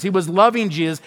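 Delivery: ordinary talk, nothing marked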